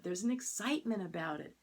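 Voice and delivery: ordinary delivery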